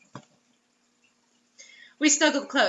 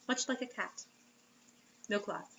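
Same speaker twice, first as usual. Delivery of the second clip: ordinary talk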